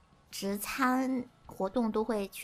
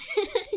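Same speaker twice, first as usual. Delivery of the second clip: short laugh. Real, not acted. Interrupted mid-intro, laughs.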